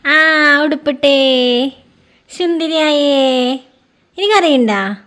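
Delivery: very slow sing-song cooing. Real, not acted. Mother praising her toddler as she dresses him, slow cooing.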